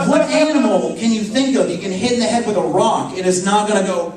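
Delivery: usual speaking voice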